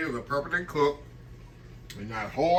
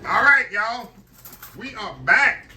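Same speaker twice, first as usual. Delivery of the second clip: high, loud cheerful return; excited welcome back; 8 semitones higher, 6 dB louder, slower than usual. Real, not acted. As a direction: announce the return with a lift. Returning greeting after break.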